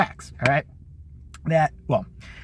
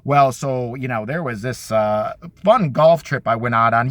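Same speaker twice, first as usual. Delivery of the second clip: low, slow host impression; 8 semitones lower, slower than usual. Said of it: "every five seconds, like".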